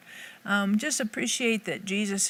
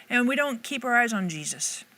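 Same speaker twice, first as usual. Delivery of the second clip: normal talking voice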